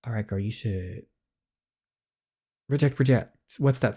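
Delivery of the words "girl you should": usual speaking voice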